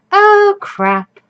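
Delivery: high, loud "oh crap"; a sudden panicked squeak; panicked yelp. Acted. Sudden panic reading game.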